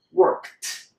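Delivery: "worked" as workt with a crisp ending; D turned into T. Shown as a demonstration. Teacher repeats it for learners to copy, ending in T. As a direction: finish "worked" on a sharp K-T click. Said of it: "Say it again".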